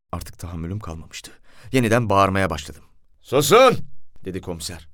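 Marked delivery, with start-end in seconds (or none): 3.2-3.8 high, loud one-word order; sharp raised-voice command; commanding shout. A narrator's reading. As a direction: shout them into silence. Commissioner silences the shouting narrator.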